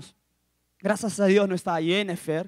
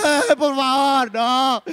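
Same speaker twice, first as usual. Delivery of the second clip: mock crying plea; 3 semitones higher, 5 dB louder, much slower than usual. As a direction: wail a plea, hammed-up. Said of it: "que voy a llorar".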